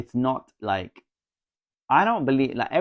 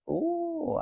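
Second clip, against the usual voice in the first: surprised ooh. Real, not acted. Reacting to a comment.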